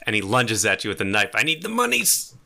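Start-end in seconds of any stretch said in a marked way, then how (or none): 1.3-2.3 high-pitched mock desperation; desperate demand. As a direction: voice leaps way up, hammed-up robber. Robber lunging with a knife.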